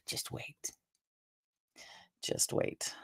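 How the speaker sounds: hushed teasing menace; whispered spoiler-tease; ominous whisper. Acted. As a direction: whisper it like a warning. Teasing what's coming in the show.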